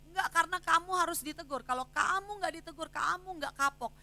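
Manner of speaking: high, loud scolding; mother lashing out at her disobedient daughter, loud and high; stern scolding mother. Retold or imitated. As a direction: scold the child hard. Mother scolding daughter while punishing.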